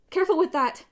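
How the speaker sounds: high, loud alarmed warning; urgent warning. A narrator's reading. Annabeth warns pirates carrying Greek fire, urgent shout. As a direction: call out sharply to stop them. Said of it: "Annabeth warned".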